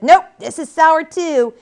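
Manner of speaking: put-on high, loud, drawn-out baby gripe; accusing baby. A narrator's reading. Newborn tries the other breast and complains, loud high voice. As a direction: reject it loudly, stretching the words. Dream baby berates its mother.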